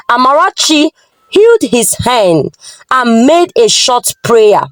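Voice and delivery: usual speaking voice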